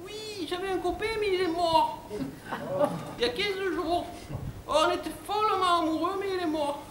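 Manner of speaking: high falsetto, plaintive and over-dramatic; bimbo recounting tragedy. Acted. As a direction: mourn in a silly high bimbo voice. Bimbo tells of dead boyfriend. (voix aiguë de bimbo éplorée)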